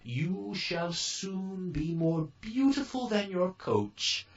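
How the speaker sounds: high, slow, kindly fairy voice; fairy godmother. A narrator's reading. Fairy godmother giving Cinderella orders, high and slow. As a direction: lift the voice high and take it slowly.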